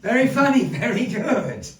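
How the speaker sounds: bursting out high and loud with delight; delighted praise. Real, not acted. Host cheering the medium's joke, delighted and loud.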